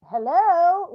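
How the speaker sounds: high, loud call for his attention; a mother calling to her son who runs by. Retold or imitated. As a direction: shout hello to catch him as he runs past.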